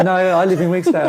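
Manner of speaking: amused, exasperated correction; laughing mock correction. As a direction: correct him through laughter. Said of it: "And you go".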